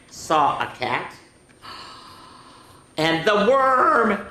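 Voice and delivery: slow storytime voice, stretching the animal names; picture-book read-aloud. A narrator's reading. Telling children they all saw the cat, dramatic storytime voice. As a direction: lean long and high on each creature, then pause.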